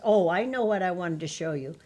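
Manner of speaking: usual speaking voice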